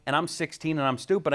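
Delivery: usual speaking voice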